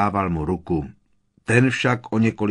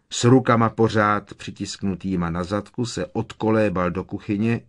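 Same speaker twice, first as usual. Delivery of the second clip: ordinary delivery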